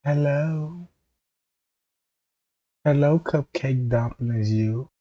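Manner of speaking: soft, put-on female greeting; attempted female voice. Shown as a demonstration. Comic showing his female voice after clearing his throat, softly put on. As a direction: soften right down to pass as a woman. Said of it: "I can't even talk like a female".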